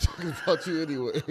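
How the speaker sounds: laughing through the words; real, helpless laughter breaking into speech; cracking up. Real, not acted. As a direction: barely get the punchline out for laughing. Cracking up at kid's funny remark.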